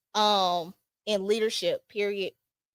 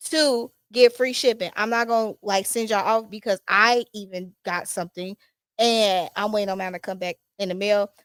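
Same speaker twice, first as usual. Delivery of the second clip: usual speaking voice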